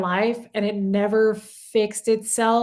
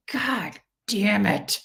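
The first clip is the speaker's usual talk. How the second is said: exasperated curse; 3 dB quieter, much slower than usual. Retold or imitated. Recalling today's realization aloud, slow exasperated curse. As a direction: draw out the curse in frustration. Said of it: "That makes me so mad".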